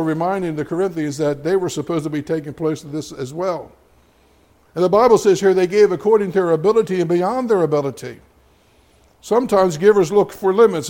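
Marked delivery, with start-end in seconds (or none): 4.7-10.9 louder, faster preaching push; preaching at full force. Acted. Pastor to his church, preaching louder and faster. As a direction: drive the point home to the congregation.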